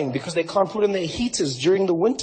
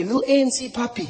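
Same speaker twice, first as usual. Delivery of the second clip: normal talking voice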